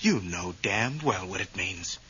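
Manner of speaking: low, quiet accusation; cold anger. Acted. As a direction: drop low and soft, seething. Husband accuses wife.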